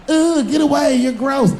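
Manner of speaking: disgusted woman. Acted. Woman rejecting hero.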